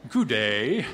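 loud, swooping posh British butler greeting; hammed-up British butler accent, drawn out; British butler answering the door, played for laughs. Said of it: "inevitably with a British accent".

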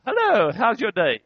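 loud, over-friendly greeting; fake cheerfulness. Upset counselor greeting a patient at five o'clock, fake cheerful. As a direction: greet brightly to hide the fury inside. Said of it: "Inside, I wanna kill everybody".

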